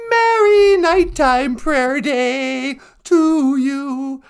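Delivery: put-on high, slow, drawn-out old lady voice; slow grandma greeting. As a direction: stretch it out like a sweet granny. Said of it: "Nana Bonjour".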